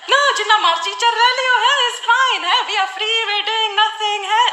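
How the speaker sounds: high, fussy Punjabi mum; mum's voice. Retold or imitated. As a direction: chirp it up like an eager mother. Mum voiced inviting relatives.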